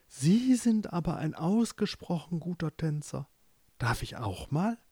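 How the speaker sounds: softly murmured dreamy sleep talk. Acted. Amanda talking in her sleep to a dream dancer, soft murmur. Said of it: "redete Amanda wieder im Schlaf".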